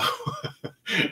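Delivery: high, breaking laugh; short high-pitched laugh at yourself. Real, not acted. Host amused at how she says a viewer's name, laughing. Laughs at own American pronunciation.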